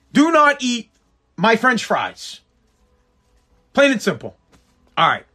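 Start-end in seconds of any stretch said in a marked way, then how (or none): 0.1-2.4 "Do not eat" pitched higher, "my french fries" dragged out slowly; mock stern warning. To podcast listeners about fry thieves, a mock stern warning. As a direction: lay down the law over the fries, word by word.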